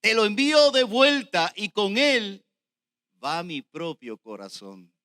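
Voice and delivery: loud proclamation easing at the close; preached scripture reading. Real, not acted. Preacher reading the letter about Onésimo, proclaimed. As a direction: declaim it forcefully, then settle on the last phrase. Reads the verse at full force.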